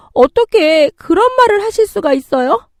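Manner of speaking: very high, loud indignant question; outraged. Acted. Daughter-in-law boiling over at mother-in-law's demand, outraged. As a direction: fire back in disbelief and fury. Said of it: "참을 수 없는 분노가 치미는".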